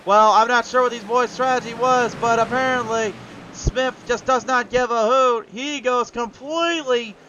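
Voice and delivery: high-pitched, punchy live race call; excited race play-by-play. Real, not acted. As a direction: call the overtake with rising excitement.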